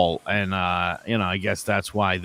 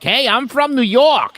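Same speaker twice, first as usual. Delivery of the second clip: imitating mom, pitched higher and louder; mom's fake New Yorker; 13 semitones higher, 6 dB louder than usual. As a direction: put on mom's proud New York front. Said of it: "but she's like".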